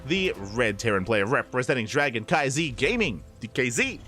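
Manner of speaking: a touch of hype, announcing the grand final players; announcer intro. Acted. Caster introducing both finalists, a touch of announcer hype.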